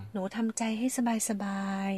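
quiet bedside comfort; soothing doctor voice. Retold or imitated. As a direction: gently lull a worried patient, imitating a kind doctor. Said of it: "ทำเสียงนี้แหละ".

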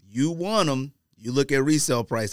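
ordinary delivery

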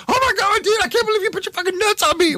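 very high, squealing outrage; hammed-up disgusted shriek; imitating shocked roommate. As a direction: shriek it like a roommate who just found nuts on his arm. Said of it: "And Paul looks over".

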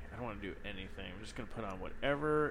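lazy mumble. Retold or imitated. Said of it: "I'm like".

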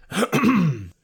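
loud deliberate throat clear before the voice trick. Shown as a demonstration. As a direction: clear the throat hard, like a cough. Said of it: "listen to my voice".

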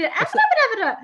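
put-on very high, loud squeaky little-girl voice; a tiny high child's squeak, loud; itty bitty voice. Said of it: "what's that sound like again".

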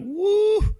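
high yelp of disgust; disgusted woo. Retold or imitated. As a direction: recoil with a sharp woo. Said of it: "I'm like".

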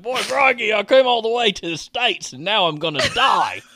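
high, hammed-up Aussie cockroach; dying Australian cockroach, played broadly; Australian accent. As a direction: put on a comic Australian accent, pitched high. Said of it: "speaking of that amazing accent".

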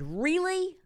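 a sharp, high, indignant "Really?"; really mad. Retold or imitated. To the woman on speakerphone, indignant. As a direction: let her have it, fed up. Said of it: "really mad".

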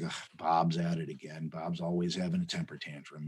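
soft, low, bored tiger grumble; a tiger too lazy to care, voiced softly; lazy tigers in the back, bored mock grumble. Tigers comment on angry tiger.

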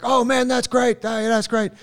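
high, loud, beaming greeting; imitating an overjoyed Wim Hof; excited Wim Hof impression. Friend retells meeting Wim Hof, high loud beaming voice. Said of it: "And he was like".

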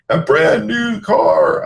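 game show host, slow and drawn out; booming prize announcer. Pretend. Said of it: "the magic words that every contestant wants to hear".

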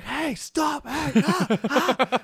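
high, strained mock shouts of protest; imitated yelling. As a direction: act out the man yelling under paintball fire. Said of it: "he got out and started yelling".

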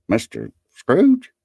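high, slow, hesitant query; taken-aback gentleman; astonished question. A narrator's reading. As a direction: draw it out in rising disbelief. Gentleman startled to recognise Scrooge.

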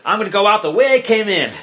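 quick, defiant retort; lightly snarled tough-guy line; mock snarl. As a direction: act out a cocky gangster's defiance. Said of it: "he comes out with a snarl".

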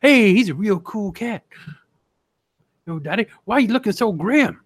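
high [name] style patter; 1940s jive talker. Retold or imitated. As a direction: swing like a hepcat crooner. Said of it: "he's like".

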